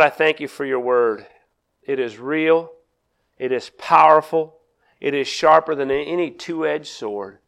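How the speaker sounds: soft, unhurried prayer voice; quiet prayer. Acted. Pastor praying softly over the congregation before the sermon. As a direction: pray humbly and softly, low.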